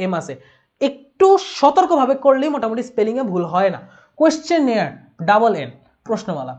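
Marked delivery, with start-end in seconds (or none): none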